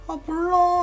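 mock sulky "whatever"; irritated dismissal. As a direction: shrug mom off, annoyed. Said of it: "짜증이 나더라고요".

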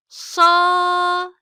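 Sa said sharp, high and loud; sharp heavy Sa. Shown as a demonstration. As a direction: push the Sa hard from the tongue sides. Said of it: "Sa harfimiz dil ucunun yanlarından keskin".

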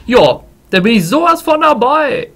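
excited. Real, not acted. Excited about the new challenge.

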